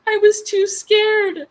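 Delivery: high, loud, drawn-out wail; hammed-up terrified whine; mock scared. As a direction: play up the fright in a squeal. Retells being scared of the bear.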